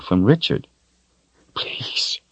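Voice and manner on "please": whispered please, no voice; a broken, tearful whisper; begging whisper. Acted. Richard begging in tears, whispered plea. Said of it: "Richard begged in tears".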